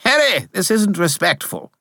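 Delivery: high prim complaint; indignant little spirit; high huffy spirit voice; piping spirit, offended. Acted. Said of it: "Bob said".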